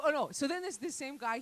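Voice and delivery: usual speaking voice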